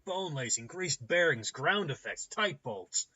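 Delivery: loud, high, punchy sales pitch; boisterous clinic owner. Acted. Rigging doctor pitching services to a customer, boisterous. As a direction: reel off the services with gusto. Ambrose lists his upgrades.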